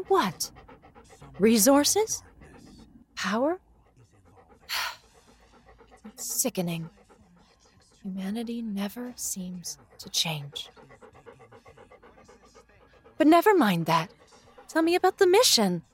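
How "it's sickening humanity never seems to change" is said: quiet disgust. Acted. Mother lamenting the news of massacres, quietly disgusted.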